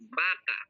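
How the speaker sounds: "baka" begun with a B sound. Shown as a demonstration. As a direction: say "baka" with lips closed for B, not V. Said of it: "V sounds like B". ("baka", binibigkas na may tunog na B)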